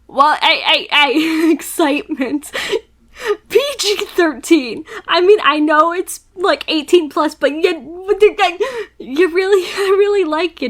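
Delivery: high, loud, laughing and stammering; flustered laughing. Real, not acted. Player reacting to a steamy scene, flustered laughter. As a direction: squeal through embarrassed giggles.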